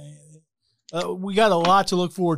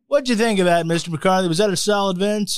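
usual speaking voice

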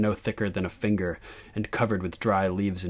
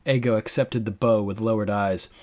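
usual speaking voice

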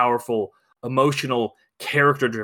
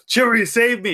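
much higher and louder, hammed-up cry for help; 1 mock desperate plea; 9 semitones higher, 4 dB louder than usual. Retelling Alan's text, Harrison Ford begging Chewie in a mock high plea. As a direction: squeal it like a drowning old hero. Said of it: "where he's just like".

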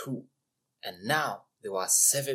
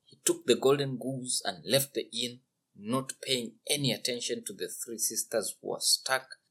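plain reading voice

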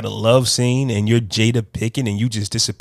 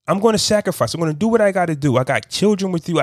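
higher-pitched, insistent voicing of a spouse; lightly acted determined spouse; 7 semitones higher, faster than usual. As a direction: lightly act out someone resolved to endure. Voices a spouse refusing divorce.

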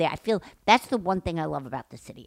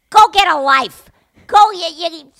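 loud, high parting jab; retold angry yell; 10 semitones higher, 12 dB louder than usual. Yelling after a man who gave the finger, angry shout. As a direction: shout it after him, sputtering at the end.

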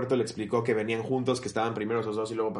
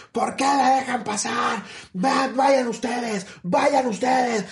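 higher, slow, stretched-out mock shouting; retelling a rude passenger yelling, pitched up and dragged out; mock angry caveman yell; 9 semitones higher, much slower than usual. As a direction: ham up an outraged brute at the airport line. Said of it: "Empezó a gritar".

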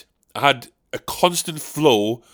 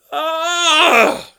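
high wounded cry; hammy cry of woe; anguished wail; 12 semitones higher, 5 dB louder than usual. Acted. Old Dick Lips outburst.

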